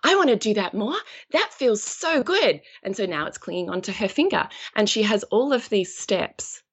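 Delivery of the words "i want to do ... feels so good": higher-pitched, joyful gush; delighted parrot. Acted. The trained parrot enjoying its treat, delighted. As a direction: relish the reward like an eager pupil. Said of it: "amplifies its experience of joy".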